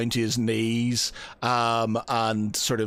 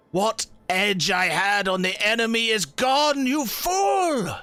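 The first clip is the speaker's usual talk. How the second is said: much higher, raging yell; furious shout; 10 semitones higher, slower than usual. Acted. NPC rages at the bard who healed his ears, furious high shout. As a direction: scream it at the fool who ruined everything.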